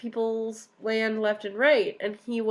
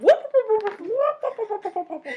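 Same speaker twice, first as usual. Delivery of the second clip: high, louder whooping like Zoidberg, fading; 12 semitones higher, 6 dB louder than usual. Retold or imitated. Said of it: "he was like Zoidberg".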